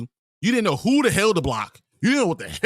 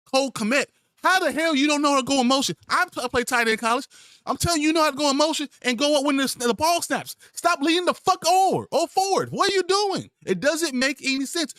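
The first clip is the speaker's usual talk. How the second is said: much higher, fast and exasperated; furious rant; 8 semitones higher than usual. Real, not acted. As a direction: berate him at full speed. Ranting furiously at a player.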